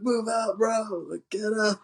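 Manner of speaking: high, insistent retold order; mock urgent warning. Keanu telling Pappas to move out, mock urgent. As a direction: urge them out in put-on alarm.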